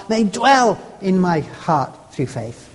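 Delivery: "dwell" hit firmly and drawn out, then slowly falling away; stress on dwell. Shown as a demonstration. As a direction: press on "dwell", then ease down. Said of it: "Not just pass".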